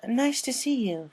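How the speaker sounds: high, slow, covering surprise; hidden surprise. Acted. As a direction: act unsurprised but it slips through. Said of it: "trying to hide the surprise in her voice".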